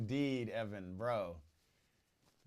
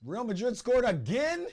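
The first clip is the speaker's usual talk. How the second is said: surprised; 12 semitones higher, 9 dB louder than usual. Real, not acted. Reacting to a goal live.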